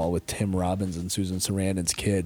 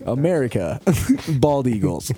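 mock patriot; 6 semitones higher, 4 dB louder than usual. Joke slogans after mock broken English.

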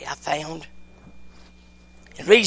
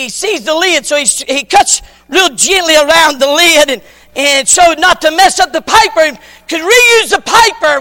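loud, high revival preaching; preaching at full force; 4 semitones higher, 11 dB louder than usual. Acted. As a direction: preach it out to the back pews. Preacher tells story at full force.

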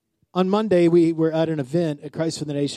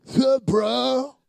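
mock bro greeting; 6 semitones higher, much slower than usual. Mocking disrespectful greeting.

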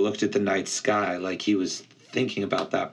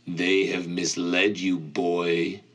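ancient cold voice; 2 semitones lower than usual. Acted. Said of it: "The voice felt ancient, cold, and heavy".

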